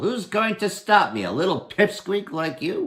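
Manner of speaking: high mocking challenge; cocky Herschel. Herschel mocks the little goblin, high cocky taunt. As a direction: tease him, raise the voice high.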